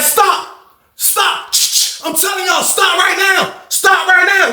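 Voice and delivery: high mock female officer shouting stop; imitated high woman's voice yelling at inmates to stop; female guard yelling. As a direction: play the lady guard ordering them to stop. Lady officer yelling at fighters.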